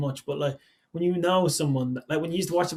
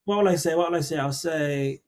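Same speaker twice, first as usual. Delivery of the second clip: ordinary delivery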